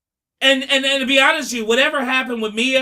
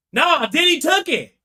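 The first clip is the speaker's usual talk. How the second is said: higher, louder retold yell; mock yell from the TV, raised and loud; 7 semitones higher, 3 dB louder than usual. Cassie answering the prosecution from home, mock yell. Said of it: "I heard Cassie yell that".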